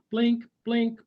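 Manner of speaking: high, loud, playful blink blink; blink sound. A narrator's reading. Turtle signals fireflies with a high blink blink. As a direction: sing out the blinks like lights.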